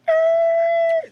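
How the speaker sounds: very high, loud brake squeal; imitated tyre screech. Man telling a snowy near-crash, imitated skid squeal. Imitates car skidding on snow.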